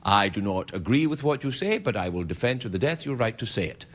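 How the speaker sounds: low, lightly voiced solemn philosopher; a hint of a learned orator; Voltaire. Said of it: "who was famous for saying".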